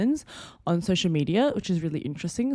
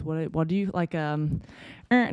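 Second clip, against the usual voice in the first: usual speaking voice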